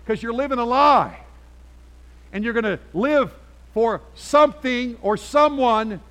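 loud, high preaching push; preaching at full force. Acted. Pastor pressing the congregation, preaching at full force. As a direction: drive it home from the pulpit. Preacher driving home the point.

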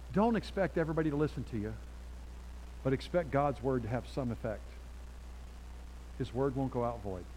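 ordinary talk, nothing marked

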